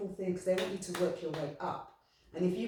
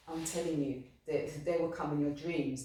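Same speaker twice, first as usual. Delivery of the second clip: ordinary talk, nothing marked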